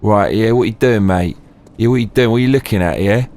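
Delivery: confrontational London geezer, piling up challenges; Cockney hard man. Acted. Mock hard man challenging someone staring at him, Cockney menace. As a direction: square up like a Cockney thug.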